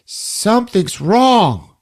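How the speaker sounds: high, slow uneasy line; worried voice. Retold or imitated. Character seeing only two runts, high slow worry. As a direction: say it slowly with dread.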